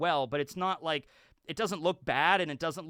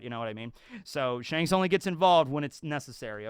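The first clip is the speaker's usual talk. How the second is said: ordinary talk, nothing marked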